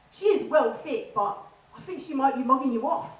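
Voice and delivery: puffed-up Essex slang delivery; Essex accent. Acted. Essex lad warning his mate about her, in an Essex accent. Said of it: "average conversation goes a little bit like this".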